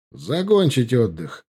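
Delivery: ordinary talk, nothing marked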